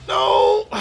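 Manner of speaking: loud, very high cry of dismay; despairing yell. Real, not acted. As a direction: cry out at the letdown. The drop turns out bad.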